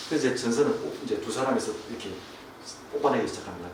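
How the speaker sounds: ordinary talk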